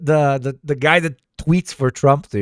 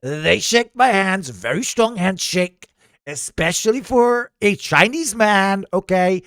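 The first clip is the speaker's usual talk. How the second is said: higher, emphatic mock-politician voice; boastful politician character; 8 semitones higher, 3 dB louder than usual. Remarking on the team's strong handshakes, boastful politician voice. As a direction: play a blustering leader with swooping stresses. Comic political figure riffing on delegation.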